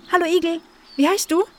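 high, curious little animal voice; high friendly greeting. Acted. Friendly greeting to a silent hedgehog. (hohe, neugierige Begrüßung)

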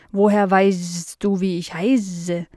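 slow housefly with drawn-out buzzing s sounds; curious housefly. Acted. Fly meeting Nala for the first time, slow and buzzy. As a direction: stretch the words like a dopey, curious fly.

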